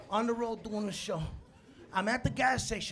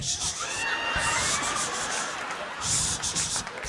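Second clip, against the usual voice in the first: soothing shushing; much slower than usual. Retold or imitated. Soothing the fallen little guy.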